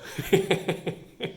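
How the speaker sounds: high wheezy giggle; helpless tittering laugh; amused laughter. Real, not acted. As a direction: crack up at your own joke. Cracks up at own observation.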